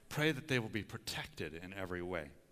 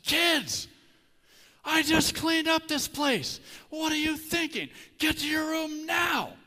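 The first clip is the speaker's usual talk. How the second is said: much higher, much louder, slow furious yell; angry dad yelling; 13 dB louder, slower than usual. Retold or imitated. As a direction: blow up at the kids over the mess. Dad yelling at kids over toys.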